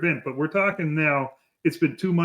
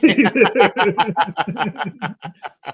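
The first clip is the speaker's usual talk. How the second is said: hearty laugh; 4 semitones higher, 4 dB louder than usual. Real, not acted. Laughs at own impression.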